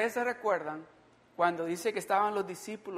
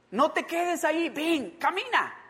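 higher-pitched urging to come; urging call; 10 semitones higher, 3 dB louder than usual. Acted. Calling Peter out of the boat, urging. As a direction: urge them out, drawing out the last word. Voicing an urgent call to walk.

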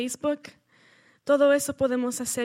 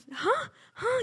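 much higher, pleading little ah; anxious child's ah; 12 semitones higher than usual. Retold or imitated. Boy begs to keep the toy, high pleading ah. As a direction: ask like a worried small boy.

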